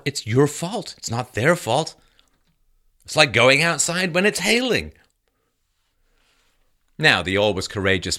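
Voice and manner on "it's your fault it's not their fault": mock blaming. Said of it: "you must have done something".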